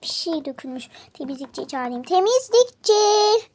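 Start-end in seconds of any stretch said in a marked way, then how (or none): 2.0-3.5 calling out. Acted. Calling out for the cleaner.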